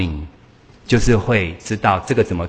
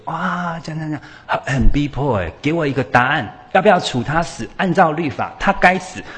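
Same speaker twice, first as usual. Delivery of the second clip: pressing accusers; 6 semitones higher than usual. Retold or imitated. Accusers pressing Jesus for answer.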